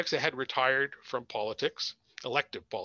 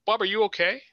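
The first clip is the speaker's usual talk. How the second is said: worried; 7 semitones higher, 7 dB louder, faster than usual. A narrator's reading. Worried call to sick colleague.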